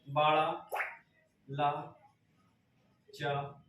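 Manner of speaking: soft, broken up piece by piece; dictation. Shown as a demonstration. Teacher spelling out a Himachal pass for note-takers, slow dictation. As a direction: dictate slowly with gaps for writing. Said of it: "लिख लीजिएगा".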